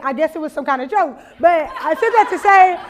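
normal talking voice

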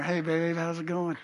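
put-on soft, low crooning to a girlfriend; mock sweet talk. Teen calling his girlfriend on the party line, soft mock croon. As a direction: murmur it softly like a teen on the phone.

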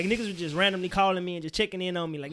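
usual speaking voice

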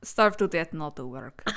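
low, fading mock Russian accent. Told to say Pavlov's letter, a mock Russian accent. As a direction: drop it deep and soft in a Russian accent. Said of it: "Say that".

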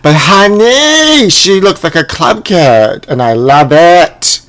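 high, slow, sassy "honey" voice; sassy drawl. Real, not acted. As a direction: stretch it out with attitude. Drawn-out sassy aside.

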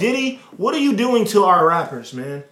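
loud, high, mock-outraged plea; exasperated outcry.

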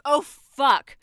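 high, loud burst of panic; panicked shout. Acted. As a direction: shout in sudden horror. Realisation that dawns, then bursts.

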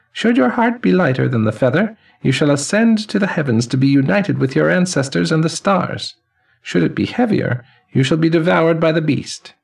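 put-on high, ringing elderly man's voice; chiming old man. Elderly man greeting the newly dead, high and chiming. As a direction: chime each word like silver cymbals and laughter.

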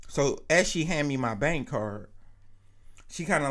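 normal talking voice

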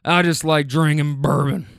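lightly voiced old smoker chuckling; smoker's laugh. Driver imitating an old rider joking about bourbon, in a lightly voiced smoker's chuckle. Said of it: "laughs with that smoker's cough".

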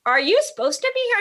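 mock the manager's challenge lightly; suspicious manager. Hotel manager voiced, suspicious.

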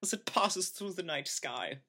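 put-on very high, wondering German accent; dreamy German accent. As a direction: marvel at the sky, German-accented. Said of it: "all of my German characters".

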